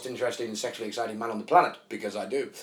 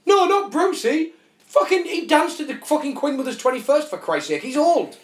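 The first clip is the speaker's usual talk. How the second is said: mock shocked fan; 11 semitones higher, 4 dB louder than usual. Mocking shocked public over Bruce Forsyth's death, hammed-up outrage. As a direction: ham up the indignant mourner. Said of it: "people would seem shocked".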